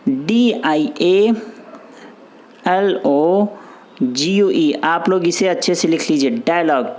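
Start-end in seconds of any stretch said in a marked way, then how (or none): 0.1-4.7 each letter spelled out very slowly; slow spelling. Shown as a demonstration. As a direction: drag out every letter for dictation. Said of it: "ध्यान सुनिए".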